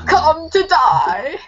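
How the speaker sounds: slow, high, drawn-out dark lord; menacing villain, stretched slow; Voldemort voice. Retold or imitated. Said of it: "it reminds me of, like, Voldemort".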